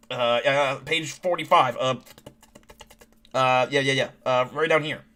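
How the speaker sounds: lightly voiced nervous scientist; [name]. Nerdy scientist showing the team his file, lightly nervous. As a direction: fumble and stammer through the pages. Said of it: "that nervous scientist, like, nerdy guy".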